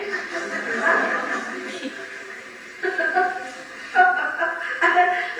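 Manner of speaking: long, breathy fit of laughing; helpless amused laugh after the punchline; long laughter. Real, not acted. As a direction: break into extended real laughter. Laughs at Americans who ran away.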